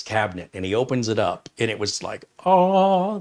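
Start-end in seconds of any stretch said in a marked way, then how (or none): 2.5-3.2 high, loud gasp of surprise; a loud surprised oh. Retold or imitated. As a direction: sound amazed at the find. Surprised at opening cabinet.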